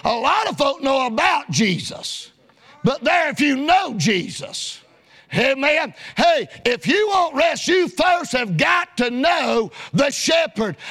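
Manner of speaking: normal talking voice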